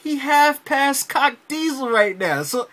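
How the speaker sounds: incredulous. Retold or imitated. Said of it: "I'm sitting there like".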